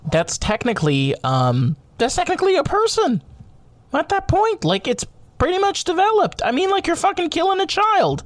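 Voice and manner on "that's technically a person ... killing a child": much higher, fast, exasperated; outraged rant. Real, not acted. Podcaster arguing his point, much higher and fast in outrage. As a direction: push it up high in disbelief.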